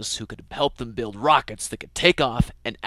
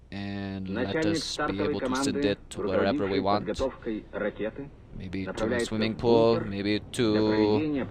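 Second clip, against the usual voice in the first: lightly hammed Russian accent, slow and deliberate; 3 dB quieter than usual. Soviet leader rallying his rocket team, hammy Russian accent. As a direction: play a jokey Soviet boss in a Russian accent. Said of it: "With my best Russian accent".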